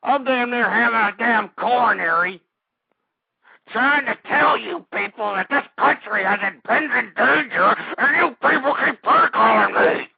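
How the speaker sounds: loud, furious rant rising in pitch; loud, worked-up fury; exasperated rage. Real, not acted. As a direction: build to an outraged yell at the pranksters. Host raging at prank callers.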